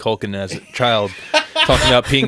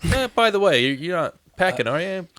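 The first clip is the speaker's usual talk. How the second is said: polite high voice; 8 semitones higher than usual. Retold or imitated.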